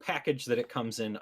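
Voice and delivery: ordinary delivery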